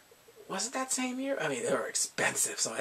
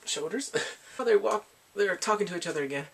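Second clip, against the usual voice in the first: normal talking voice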